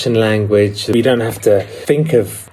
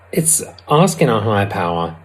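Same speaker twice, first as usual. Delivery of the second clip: ordinary delivery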